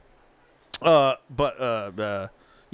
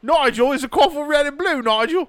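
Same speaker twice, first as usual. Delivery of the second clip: hammed-up British accent, pitched up; mock British accent; 8 semitones higher, 4 dB louder than usual. Brit pestering Nigel about carpet, hammed-up British accent. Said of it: "Now do it".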